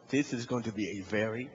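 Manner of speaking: low, weighty deadpan; resigned dread. Retold or imitated. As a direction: let it sink in with low resignation. Resigned inner remark, slow and low.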